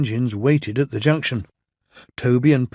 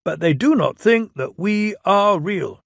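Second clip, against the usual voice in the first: raised, pompous official voice; Fat Controller voice; 7 semitones higher, 4 dB louder than usual. Acted. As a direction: speak as a stern railway boss.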